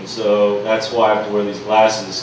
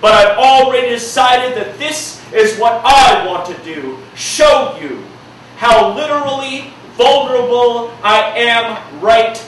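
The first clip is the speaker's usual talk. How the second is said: rhythmic slam poetry delivery, punching key words; spoken word poem; 12 semitones higher, 4 dB louder, slower than usual. Acted. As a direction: perform it like a slam poem, building intensity. Performs his spoken-word poem.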